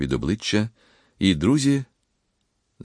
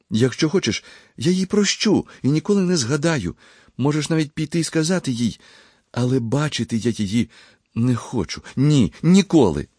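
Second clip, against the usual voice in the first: high-pitched, agitated refusal; intense agitation; 8 semitones higher than usual. A narrator's reading. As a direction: shaken young man, voice pitched high. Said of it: "Сильне збудження потрясло юнака".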